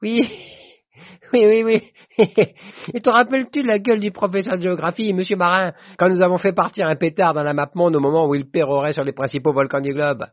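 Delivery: high, loud, breaking into laughter; laughing reminiscence. A narrator's reading. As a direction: crow over an old prank, laughing as you go. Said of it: "Et il riait, tapait du poing sur la table". (hilare, voix aiguë et forte)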